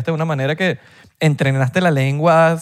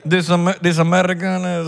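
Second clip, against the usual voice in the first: higher, mock American accent; gringo fingido; 4 semitones higher than usual. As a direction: pretend to be American, voice raised. Both play at speaking gringo English. (acento americano fingido, más agudo)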